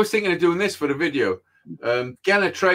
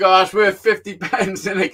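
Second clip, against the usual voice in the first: mock taunting; 4 semitones higher, 5 dB louder than usual. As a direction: imitate mates teasing with a laugh in it. Irish guys taunt him over offer.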